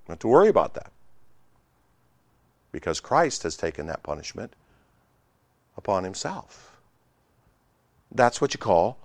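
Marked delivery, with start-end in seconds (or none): 2.7-6.7 low, soft and spaced out; hushed, low-pitched assurance; solemn hush. Real, not acted. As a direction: drop right down into quiet reverence. Solemn quiet after loud passage.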